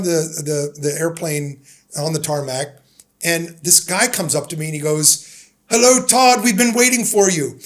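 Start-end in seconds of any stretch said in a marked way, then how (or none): 5.7-7.6 put-on higher, louder, a lightly done refined English accent; polished English accent. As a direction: greet him warmly in a crisp, proper English accent. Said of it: "in this accent that was better English".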